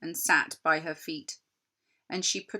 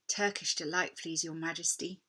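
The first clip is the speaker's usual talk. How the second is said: put-on quieter, shy polite boy; timid boy; 2 semitones lower, 5 dB quieter than usual. Edmund quietly asks the queen for Turkish delight. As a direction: ask timidly.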